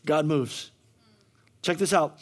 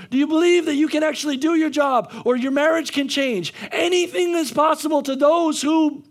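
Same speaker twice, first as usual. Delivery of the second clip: preaching; 6 semitones higher than usual. Acted. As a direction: hammer each "believe" to the congregation. Altar-call string of questions.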